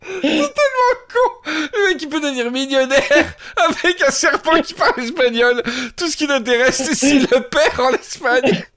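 laughing so hard it squeaks; crying with laughter while talking. Real, not acted. Laughing hard after the joke.

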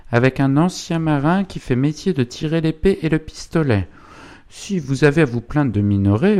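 high-pitched indignant tirade from a wife; Zélie. Acted. Mother defends son and orders visitor out, indignant. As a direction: rail at the intruder, pitch held high.

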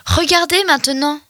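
plain reading voice

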